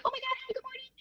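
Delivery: chirpy as a morning greeter; cheery greeting. Retold or imitated. Her usual cheery greeting at work.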